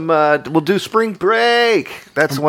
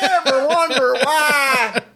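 high, slow, hammed-up southern comic voice; mock stand-up catchphrase, drawn out; Jeff Foxworthy impression; 11 semitones higher, slower than usual. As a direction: ham up a southern comedian's drawl. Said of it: "Jeff Foxworthy. That voice drives me crazy".

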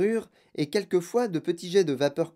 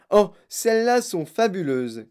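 loud, higher, thrilled exclamation; Monsieur Wonka crying out; 5 semitones higher, 5 dB louder than usual. A narrator's reading. Wonka praising his fizzy drinks, crying out. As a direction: burst out with enthusiasm. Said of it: "cria monsieur Wonka".

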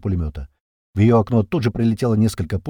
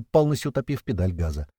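usual speaking voice

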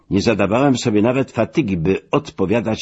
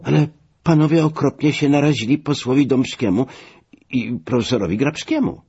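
high-pitched, worried complaint; lamenting; 7 semitones higher than usual. A narrator's reading. Passenger warning that the delegates are offended, lamenting. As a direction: keep bemoaning it in a raised pitch. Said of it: "ubolewał nadal pan Maciej".

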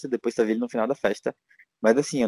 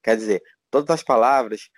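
ordinary talk, nothing marked

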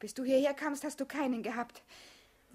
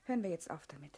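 whispered; 7 semitones lower, 7 dB quieter, faster than usual. Acted. Whispered end to teasing.

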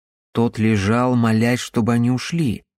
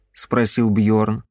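plain reading voice

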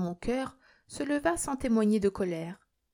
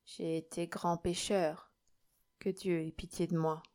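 piteous murmur; 2 semitones lower, 7 dB quieter than usual. A narrator's reading. Old sinner begs God's mercy, piteously murmuring. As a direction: confess quietly and pitifully. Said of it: "murmura Nikita d'un ton piteux".

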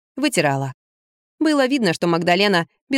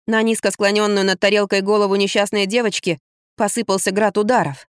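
ordinary delivery, nothing marked

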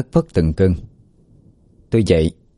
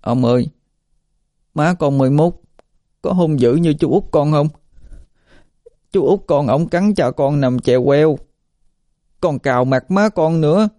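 put-on high-pitched scared little boy voice; frightened stammering child; 8 semitones higher than usual. Frightened boy tells the old man about his violent uncle, high scared child voice. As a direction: plead in a small, much higher child's voice. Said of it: "thì nó lắp bắp nói luôn".

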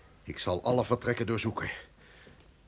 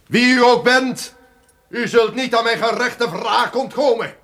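much higher and louder, a raging threat; furious shout; 11 semitones higher, 9 dB louder than usual. Acted. The duke challenges the unseen voice, shouting in fury. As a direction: hurl the vow of vengeance into the dark.

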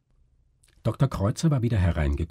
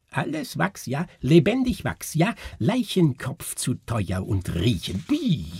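high, jerky foreign showman voice; fremdländischer Ägypter; 6 semitones higher than usual. A narrator's reading. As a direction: squawk it in broken phrases, pitch jumping high. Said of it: "sagte plötzlich hinter ihnen der Ägypter". (hohe, abgehackte Stimme eines Ägypters)

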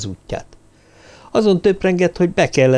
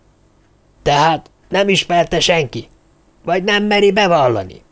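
challenging interrogation; 6 semitones higher than usual. A narrator's reading. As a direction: press them for a confession. Törpapa interrogates the silent group.

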